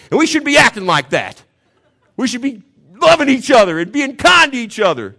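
high, loud, laughing through the lecture; laughing retelling. Real, not acted. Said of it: "I'm lecturing everybody in the gym".

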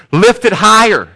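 high, loud cheering cry; rousing shout from the crowd; crowd cheering him on. Acted. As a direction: urge him upward like an excited crowd. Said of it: "the crowd would cheer for him".

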